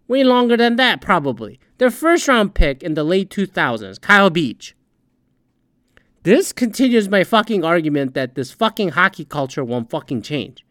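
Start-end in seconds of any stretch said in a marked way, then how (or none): none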